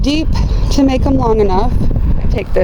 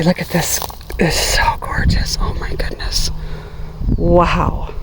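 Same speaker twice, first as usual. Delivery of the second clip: hushed whisper of delight; whispered awe; 2 semitones lower, 5 dB quieter than usual. Real, not acted. Gardener marveling at a bloom in a whisper. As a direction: breathe it out in awe. Admiring flowers up close.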